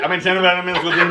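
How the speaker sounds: rattled off very fast; fast imitation. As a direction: gabble it like a fast talker. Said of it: "speak a hundred mile an hour niece".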